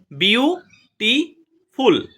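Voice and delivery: Beautiful slowly as বিউ ... টি ... ফুল, high beats; three separate beats in beautiful, falling on the last; split into syllables. Shown as a demonstration. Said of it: "তিনটে করে syllable". (Beautiful তিন ভাগে ভেঙে, ধীরে)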